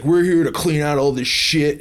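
loud, high-pitched angry ranting; aggressive rant. Retold or imitated. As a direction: bark it like an aggro stranger. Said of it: "he's like".

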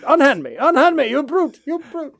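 high, hammed-up damsel protest; indignant victim. Scientist being thrown out protests, in high mock indignation. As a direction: protest in a high, melodramatic put-on voice.

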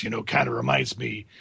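put-on low, gravelly old retired Marine. Shown as a demonstration. Podcast guest showing his old Marine character voice. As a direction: growl it out like a grizzled drill sergeant. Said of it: "affecting a voice like this".

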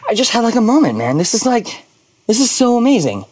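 a retold gush of wonder, voice jumping up; awed excitement. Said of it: "I was like".

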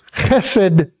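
chesed with a raspy throat-scraped h; chesed, scraping the first sound in the throat; Hebrew throaty h. Shown as a demonstration. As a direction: open chesed on a long guttural kh. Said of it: "did you hear how I said it".

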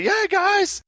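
high excited yeah; excited cheer. Shown as a demonstration. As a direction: cheer on the guys. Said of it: "Can you say".

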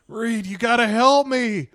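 high, loud desperate begging; desperate plea. Retold or imitated. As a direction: beg him for help. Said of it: "but of just like".

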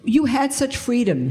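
lightly voiced friend scolding; imitating friend. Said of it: "she said to me".

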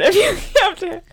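talking through giggles; laughing. Real, not acted. Both laughing mid-question.

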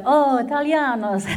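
a hint of sudden discovery; Italian guess. Frankfurt staff deciding she is Italian, lightly mock Italian. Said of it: "they're like".

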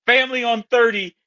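hammed-up squeaky teen rallying the team; awkward kid's pep talk. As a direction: imitate a fumbling teen leader giving a speech. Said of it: "So you're just like".

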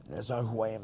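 ordinary talk

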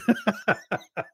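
a high, soft burst of laughter; high breathy laugh. Real, not acted. A husband laughing at the lost bag, high laugh. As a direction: laugh at the punchline. Laugh after story punchline.

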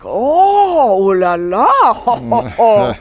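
high, loud hammed-up French ooh la la, slowly drawn out; mock French gush. Guest imitating French locals gushing over her French. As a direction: gush like a delighted Parisian waiter. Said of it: "And then they're like".

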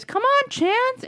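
very high, slow, singsong call; inviting, not goading. Retold or imitated. Jamie calling Chance inside, simply inviting. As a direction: invite the dog in sweetly. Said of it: "and not, like, a goading".

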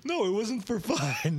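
high, drawn-out protest through laughter; amused, embarrassed insistence; laughing denial. Real, not acted. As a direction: deny it while cracking up. Admits embarrassing outburst while laughing.

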